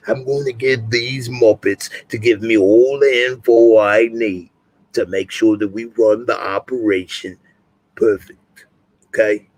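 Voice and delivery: a lightly put-on Cockney growl; Butcher impersonation. Streamer plugging his website in Butcher voice, mock-Cockney. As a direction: sign off as Billy Butcher would. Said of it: "Butcher impersonation".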